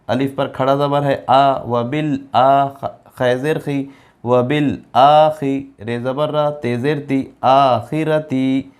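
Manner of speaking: ordinary talk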